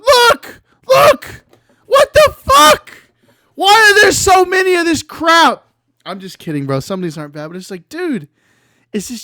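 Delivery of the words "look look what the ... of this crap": very high, loud shrieking disbelief; shrieking shout. Real, not acted. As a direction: scream at the screen in shock. Shocked by flood of images.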